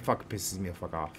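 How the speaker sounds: ordinary delivery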